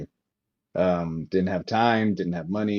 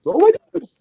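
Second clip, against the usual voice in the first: high exclaimed oh my god; 7 dB louder, faster than usual. Retold or imitated. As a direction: pitch jumps way up, loud and astonished. Exclaiming about unfinished game.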